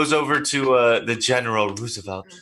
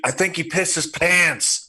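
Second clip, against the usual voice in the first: high, drawn-out call without real volume; mock crowd yell; 6 semitones higher than usual. Onlooker heckling the beaten fighter before the crowd, mock yell. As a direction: call it out as if to a crowd, held back. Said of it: "yell out into the cl-, uh, the, the crowd".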